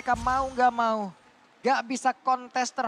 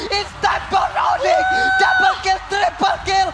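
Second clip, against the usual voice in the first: slow, stretched hype roar, much higher; fighting game announcer; 7 semitones higher, 7 dB louder, slower than usual. Real, not acted. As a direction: bellow each kill call like an arena announcer. Comeback teamfight, caster roars.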